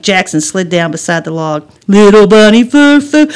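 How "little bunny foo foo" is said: loud mocking chant; singsong taunt. Brother by the log teasing sister, taunting. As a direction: chant it to needle a sibling.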